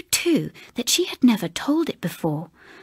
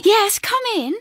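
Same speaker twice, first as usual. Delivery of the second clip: high, breathless eager echo; girl echoing the invitation in a high whisper; 12 semitones higher than usual. A narrator's reading. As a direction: chime in eagerly, much higher. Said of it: "echoed the other".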